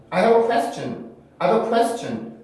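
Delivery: native-like question, stress on the first vowel; English-style pronunciation. Shown as a demonstration. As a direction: question with smooth 구에 glide. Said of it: "영어식으로 발음을 하면". (영어식 발음 시범)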